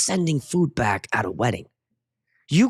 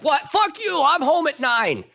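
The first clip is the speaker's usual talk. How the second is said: much higher, mock indignant voice, slow at the end; mock indignant commenter; 13 semitones higher, slower than usual. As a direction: play an outraged commenter. Reading a commenter's reply aloud.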